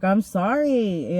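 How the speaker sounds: slow, drawn-out, whiny sorry; exaggerated meek apology; mock submissive apology. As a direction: grovel like a belly-up dog. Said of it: "a dog with their belly up".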